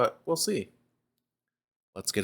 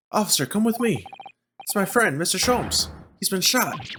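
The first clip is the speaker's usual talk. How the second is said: put-on higher voice as the anxious young lawyer; urgent young lawyer; 10 semitones higher than usual. Young lawyer tells the officer his mentor was shot, higher and urgent. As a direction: plead with the officer to hurry.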